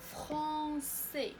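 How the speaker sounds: "فرونسي" slow, two held syllables; model pronunciation. Shown as a demonstration. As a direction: stretch fron, then a clear sé in "فرونسي". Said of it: "باش تنقراو فرونسي". (نطق "فرونسي" ببطء وبسين واضحة)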